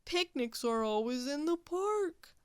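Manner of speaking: droopy, complaining Walrus voice; gloomy Walrus. Acted. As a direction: whine it like a sulky walrus. Walrus voice continues.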